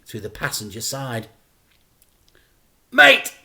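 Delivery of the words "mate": high, loud urgent call; shout. Acted. Dad shouts to Frank from the revving car, loud and high. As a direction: yell at the boy to get in. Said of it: "shouted Dad".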